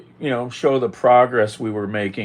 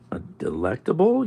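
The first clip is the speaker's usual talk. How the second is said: normal talking voice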